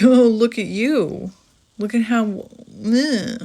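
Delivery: high, loud sneering mimicry of the other person; mock them with a nasty singsong taunt. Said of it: "maybe they even said to you then".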